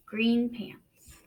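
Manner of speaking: slow, hushed storybook reading; read-aloud narration. A narrator's reading. Teacher reading a picture book to the class, hushed. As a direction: draw young listeners into the story.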